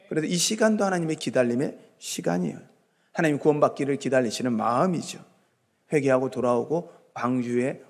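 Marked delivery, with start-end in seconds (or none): none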